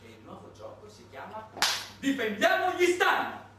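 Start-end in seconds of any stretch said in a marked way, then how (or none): 2.0-3.5 high, loud, slow rallying cry; shouted slogan. Acted. Naming the superpowers' new game for the audience, shouted slogan. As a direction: bellow it like a campaign banner.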